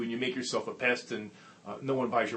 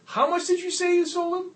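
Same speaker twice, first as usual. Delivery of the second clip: much higher and louder, near a yelp; astonished; 10 dB louder than usual. Retold or imitated. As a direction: boss demanding the figure again. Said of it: "was stupefied".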